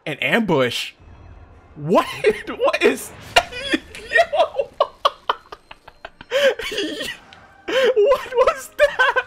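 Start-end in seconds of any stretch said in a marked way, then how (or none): 2.1-4.7 high squeals between bursts of laughing; incredulous laughter. Real, not acted. Player ambushed by monster, squealing with laughter. 6.3-9.2 slow, very high squeal through laughter; shrieking laughter. Real, not acted. Player shocked by ambush, laughing shriek.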